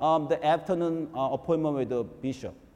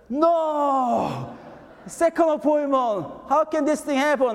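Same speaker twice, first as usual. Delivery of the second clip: much higher, startled cry; retold panic; 10 semitones higher, 3 dB louder, slower than usual. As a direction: relive the shock of a second missed meeting. Realizing he missed the appointment.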